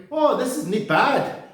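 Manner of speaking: high, slow, hammed-up Glaswegian; mock Scottish accent. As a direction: approve like a Glasgow chippy customer. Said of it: "my brilliant Scottish accent".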